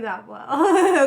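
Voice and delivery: slow, loud laughing admission. Real, not acted. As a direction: laugh while confessing it slowly. Admits hiding feelings, laughing.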